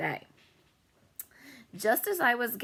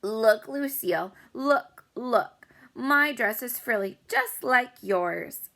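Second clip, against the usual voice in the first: put-on higher, louder pushy girl showing off; eager; 4 semitones higher, 5 dB louder, slower than usual. A narrator's reading. Girl showing off her frilly dress to Lucille, eager and louder. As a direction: insist she look, then stretch the last words. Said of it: "she said".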